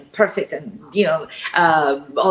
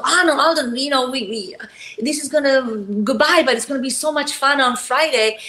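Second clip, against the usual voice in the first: higher-pitched, gushing retold goodbye; eager goodbye; 7 semitones higher than usual. Actress replaying her goodbye to a departing co-star, eager and high. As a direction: act out a rushed, eager farewell.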